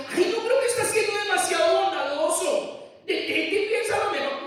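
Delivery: high, loud mocking wife voice; mock wife interceding. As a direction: parody her pleading. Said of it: "intercediendo diciendo".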